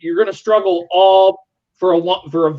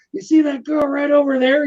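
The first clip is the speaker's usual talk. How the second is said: put-on loud, pitched-up drunken call to a buddy; 10 semitones higher than usual. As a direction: call it out high and tipsy across the party. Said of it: "And I'm kind of being loud too".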